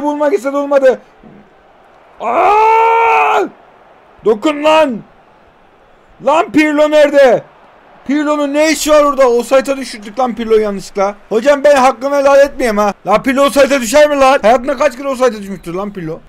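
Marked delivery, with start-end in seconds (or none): 2.2-3.4 loud, high sudden yell; shocked outcry at the play; surprised shout. Real, not acted. 4.2-16.2 loud, higher exasperated complaints; venting at the offside; frustrated rant. Real, not acted. Angry at offside mistake.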